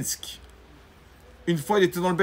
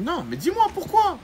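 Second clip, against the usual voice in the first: insistent high question; 12 semitones higher than usual. Acted. Rabbi insisting with the mother.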